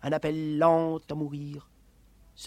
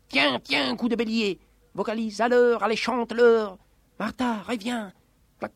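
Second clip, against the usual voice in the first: usual speaking voice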